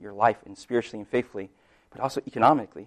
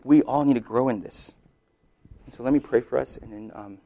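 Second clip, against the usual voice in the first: ordinary delivery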